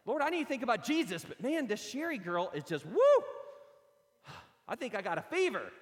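breathless smitten prayer; lovesick excitement. Retold or imitated. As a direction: gush like a smitten college kid. Lovesick college boy praying.

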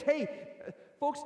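normal talking voice

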